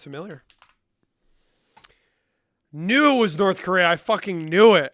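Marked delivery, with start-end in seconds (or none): 2.7-4.9 loud self-satisfied gloat; triumphant. Real, not acted. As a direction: crow over being right. Triumphant realization.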